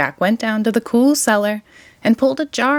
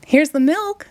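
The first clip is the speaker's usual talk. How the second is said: put-on higher, bright boyish call; excited shout; 8 semitones higher than usual. A narrator's reading. Jack proudly brings the egg, excited shout. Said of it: "shouted Jack".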